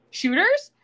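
imitated perky question. Billy suggests Shooters after planner leaves, perky retold question. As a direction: pop it up high as a suggestion.

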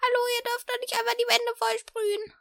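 high falsetto scolding lady; scolding woman. Acted. Passer-by woman catching the sprayers, in falsetto. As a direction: squeak out an outraged woman's complaint.

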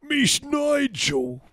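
very high, stretched-out British squeal; mock British bishop. British bishop saying his name, exaggerated British accent. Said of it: "make fun of British people".

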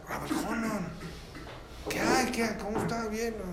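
high, lightly acted astonishment; mock fluster at an unexpected guest, kept soft; flustered surprised greeting. Rich host finding the rabbi in his kitchen, mildly flustered greeting.

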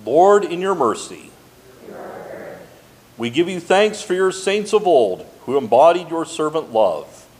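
ordinary talk

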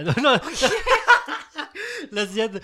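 bursting into loud laughter; can't stop laughing at the question. Real, not acted.

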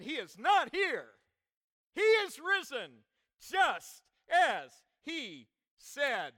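loud, ringing proclamation; preaching. Acted. Preacher quoting angels at the tomb, loud proclamation. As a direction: announce it boldly, slowing to weighted single words.

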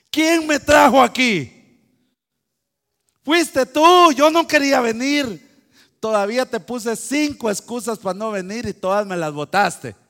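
loud, high indignant outburst; preacher voicing an angry Moses; indignant protest. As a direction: stand up to God and push back hard. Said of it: "cómo se le planta a Dios".

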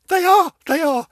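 high, loud, thrilled; gushing with delight; excited insistence. Real, not acted. Telling viewers the show films nearby, thrilled.

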